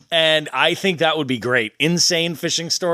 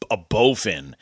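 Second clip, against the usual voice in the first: ordinary delivery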